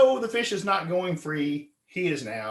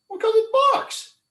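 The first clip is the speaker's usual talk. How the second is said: teasing; 7 dB louder than usual. Retold or imitated. Friends tease gullible Bill.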